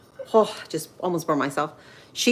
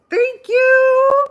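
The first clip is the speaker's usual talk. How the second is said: high, loud giggling thank-you; excited laughing thanks; 8 dB louder than usual. Real, not acted. Streamer thanking viewers for nearly a thousand subscribers, excited laughter.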